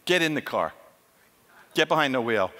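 low-pitched, curt officer's command; curt orders. Acted. Israeli policeman to nervous driver, curt orders. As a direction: give short orders with no room to argue.

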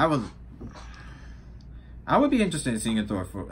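ordinary talk, nothing marked

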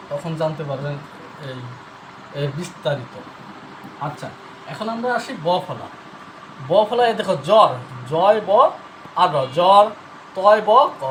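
usual speaking voice

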